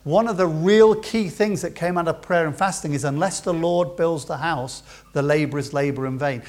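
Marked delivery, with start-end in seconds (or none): none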